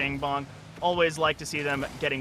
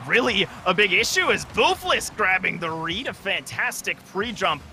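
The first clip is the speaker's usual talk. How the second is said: rising, louder play-by-play; excited commentary; 6 semitones higher, 3 dB louder than usual. Real, not acted. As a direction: climb in pitch as the save happens. Live play-by-play of a save.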